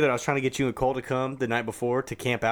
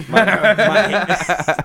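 laughing through words; 5 semitones higher, 9 dB louder than usual. Real, not acted. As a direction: laugh mid-sentence. Laughing while speaking.

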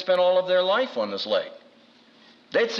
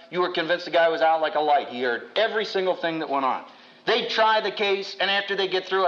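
ordinary talk

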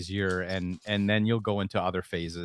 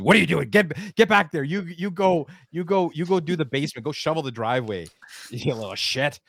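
higher, louder gruff bossing; mock angry dad; 7 semitones higher, 5 dB louder than usual. Father retells barking chores at his son, louder and higher mock anger. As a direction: bark orders at a lazy son. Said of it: "He says".

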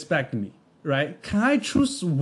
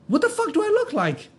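incredulous; 9 semitones higher than usual. Real, not acted. Exasperated rhetorical outburst.

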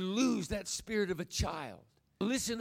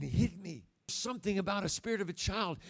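ordinary delivery

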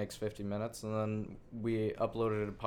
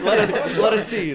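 loud and higher through laughter; laughing; 9 semitones higher, 13 dB louder than usual. Real, not acted. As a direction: crack up mid-sentence.

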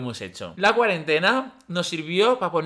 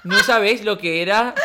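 laughing; 4 semitones higher, 7 dB louder than usual. Real, not acted. Recalling fit of giggles.

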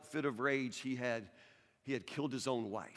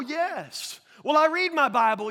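high, loud self-satisfied churchgoer; mock churchgoer; 12 semitones higher, 12 dB louder than usual. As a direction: play the smug believer, pitched up and loud. Congregant voiced by pastor.

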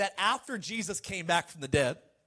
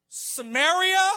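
high, loud, drawn-out rising question; incredulous question; 11 semitones higher, 7 dB louder, much slower than usual. Acted. Disciples questioning where to witness, incredulous. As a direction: ask it in mock disbelief. Acting out listeners' shocked questioning.

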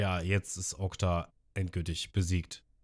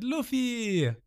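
high called name; happy call; 13 semitones higher than usual. Retold or imitated. Nami calling out to Luffy, high happy call. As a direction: call his name with relief. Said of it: "lächelt und schreit".

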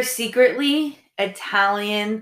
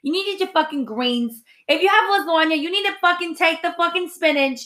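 put-on Queens Italian accent. Mocking a Queens Italian mom to podcast listeners, hammed up. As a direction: ham up a Queens Italian housewife. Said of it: "we talk like this like Italian women".

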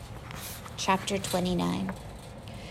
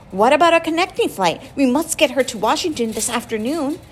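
urgent pleading; 6 semitones higher, 6 dB louder than usual. A narrator's reading. Father pleading at airport counter.

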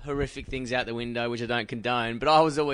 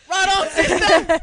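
much higher, louder, slow sassy praise; sassy cheer; 8 dB louder, slower than usual. Retold or imitated. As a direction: cheer her on like a hyped friend. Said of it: "then she's like".

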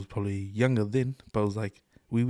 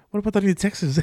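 mock indignation; 10 semitones higher, 8 dB louder than usual. Mocking government priorities.

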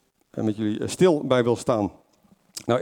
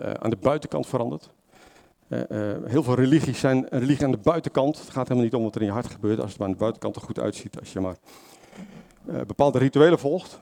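normal talking voice

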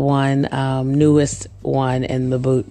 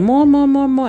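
high, loud chant of more; kids begging; 11 semitones higher, 4 dB louder than usual. Retold or imitated. Toddlers at snack time loudly begging for more. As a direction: beg like hungry toddlers. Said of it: "they just kept saying".

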